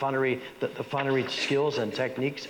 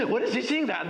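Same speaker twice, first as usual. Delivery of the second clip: surprised audience; 10 semitones higher than usual. Retold or imitated. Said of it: "audience wheeling around at the dagger".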